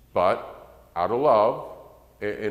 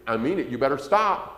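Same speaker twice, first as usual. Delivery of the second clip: mild parent threat; 5 semitones higher, 4 dB louder than usual. Retold or imitated. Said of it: "suppose he does it again".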